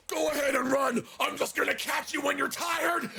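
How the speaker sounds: high, menacing mock shout; chasing taunt yell. Man chasing a girl in the game, taunting yell. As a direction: yell it after someone you're chasing.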